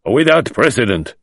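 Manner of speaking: a firm, stern verdict; stern judgment. Acted. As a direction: pronounce it with severity. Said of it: "his sternness of manner returning".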